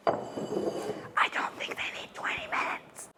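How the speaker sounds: whisper. Real, not acted. Whispered aside.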